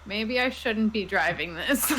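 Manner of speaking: normal talking voice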